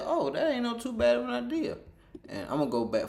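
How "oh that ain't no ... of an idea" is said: high, sing-song quoted agreement; chirpy retold okay; high mock reply. Quoting group reply to offer.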